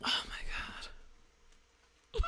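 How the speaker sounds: hushed disbelief; whispering. Real, not acted. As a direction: whisper it under breath. Whispered aside.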